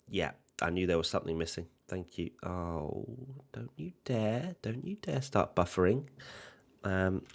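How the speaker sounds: ordinary delivery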